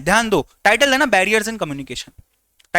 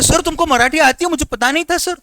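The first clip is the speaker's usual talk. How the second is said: surprised student; 7 semitones higher, 4 dB louder than usual. Retold or imitated. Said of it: "बोले".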